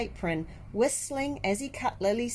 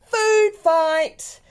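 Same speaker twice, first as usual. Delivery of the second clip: much higher, much louder shout; yelled; 13 semitones higher, 12 dB louder than usual. A narrator's reading. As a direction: call out to the whole lunchroom. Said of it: "Somebody yelled".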